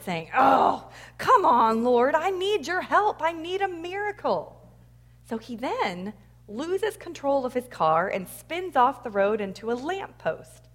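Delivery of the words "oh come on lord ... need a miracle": exasperated plea, loud start, rising higher; acted irritation. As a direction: play an irritated man begging for help. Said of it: "Bruce just gets irritated".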